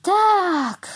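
long, high, loud cheer; triumphant cry. A narrator's reading. Cow as the board finally flies off, triumphant cry. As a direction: cry out in victory. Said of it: "zawołała mama mu".

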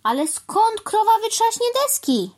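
very high, loud incredulous question; exclaiming. A narrator's reading. Crow asking where a cow gets boards, exclaiming. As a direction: call it out in high-pitched disbelief. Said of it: "zawołał pan Wrona".

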